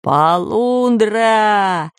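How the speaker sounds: long, drawn-out alarm call; pirate cry. A narrator's reading. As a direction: holler it like a pirate, stretched out. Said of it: "закричал пират Бульбуль".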